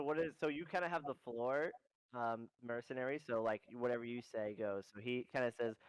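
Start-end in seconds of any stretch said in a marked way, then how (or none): none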